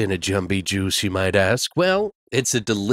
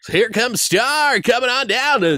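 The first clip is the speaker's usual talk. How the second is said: a hint of a strip club DJ, slow; 11 semitones higher than usual. Host lightly playing a strip club DJ announcing a dancer. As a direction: lightly hype up the next dancer. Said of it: "I totally sound like it".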